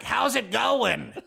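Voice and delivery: drawn-out comic celebrity impression. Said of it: "[name] was like".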